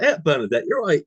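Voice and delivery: high, loud, chirpy opener; retold phone greeting. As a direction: re-enact an over-friendly phone greeting.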